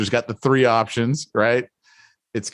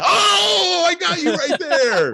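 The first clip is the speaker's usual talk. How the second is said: much higher, crowing shout, slow on the last words; triumphant cry; much slower than usual. Retold or imitated. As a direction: gloat like you just caught him out. Gloating after catching agent.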